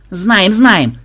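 loud, high, eager cry; impatient shout. Acted. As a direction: cut him off, can't wait. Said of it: "нетерпеливо закричала внучка". (нетерпеливый крик внучки)

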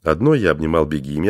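usual speaking voice

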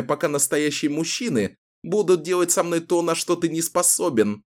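high, scornful mockery; defiant woman taunting. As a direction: needle him, sneering. Taunting the captor. (Кэлен издевается над главарём)

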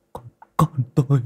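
a father's grief breaking out; broken wail starting. Acted. Father finding his drowned daughter, choked cry. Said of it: "mà gào lên".

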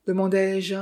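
ordinary delivery, nothing marked

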